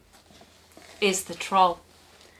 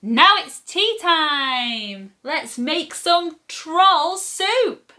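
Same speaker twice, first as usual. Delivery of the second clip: put-on slow, high storybook children's yell; 8 semitones higher, 4 dB louder, slower than usual. A narrator's reading. Children lifting troll in the scoop, slow high yell. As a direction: draw out the yell, rising high. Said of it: "and they yell".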